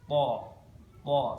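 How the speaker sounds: "Po" as loud, heavy Arabic tha; thick, emphatic t in "Po"; tongue at tooth roots. Shown as a demonstration. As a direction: press the tongue behind the upper front teeth on "Po". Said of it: "Ditempelkan ke tempat tumbuhnya".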